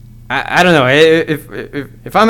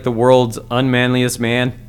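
usual speaking voice

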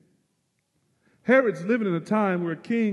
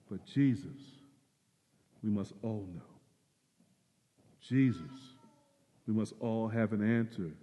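softer and lower, sincere; quiet earnest appeal; 4 semitones lower, 4 dB quieter than usual. Real, not acted. Pastor urging everyone to answer about Jesus. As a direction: plead gently.

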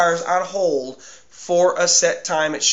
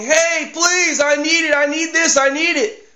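high, insistent begging cry; mock shouting plea; 7 semitones higher than usual. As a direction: call out desperately, without a full yell. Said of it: "yelling, shouting".

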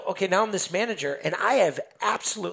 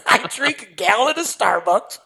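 excited retelling; 5 semitones higher, 5 dB louder than usual. Retold or imitated. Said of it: "And I'm excited".

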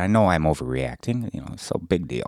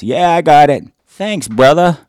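cheery shout; 11 semitones higher, 12 dB louder than usual. Retold or imitated. Guys' quote and thanks, retold.

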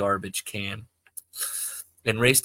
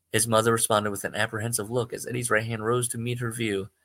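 usual speaking voice

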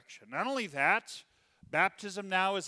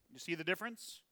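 normal talking voice

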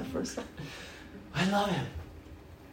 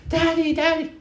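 put-on much higher childlike calling; little girl calling; 12 semitones higher than usual. Obama's daughter running in, high childlike call. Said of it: "she's like".

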